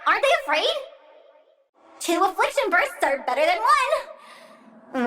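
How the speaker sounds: usual speaking voice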